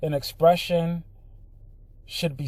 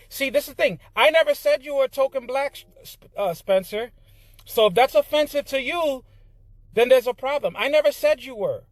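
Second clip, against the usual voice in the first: indignant, much higher-pitched insistence; heated rebuttal; 9 semitones higher than usual. Real, not acted. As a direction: push back hard, voice raised high.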